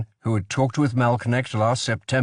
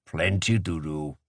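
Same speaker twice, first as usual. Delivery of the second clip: low, soft and curt; briefly; 7 semitones lower, 4 dB quieter than usual. Acted. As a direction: cut it short, gravely. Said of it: "he said briefly".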